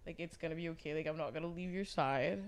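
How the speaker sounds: soft, gentle reassurance; softly comforting a crying friend; tender comfort. Retold or imitated. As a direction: soothe her quietly. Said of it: "let my inner Amy in".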